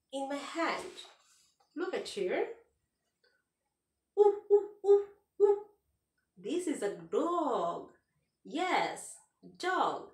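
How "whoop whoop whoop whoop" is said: loud high woofing barks; dog bark. Shown as a demonstration. Teacher barks for the kids, loud and high.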